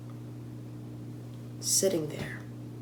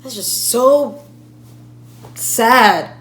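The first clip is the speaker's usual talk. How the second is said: loud, emphatic distress on the family's behalf; upset outburst; 2 semitones higher, 10 dB louder than usual. Acted. Speaker imagines the family's grief, upset outburst. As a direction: burst out, loud and insistent.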